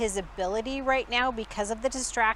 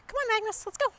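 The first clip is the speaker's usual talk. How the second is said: high coaxing dog call; 12 semitones higher, much faster than usual. Real, not acted. Calling the dog inside.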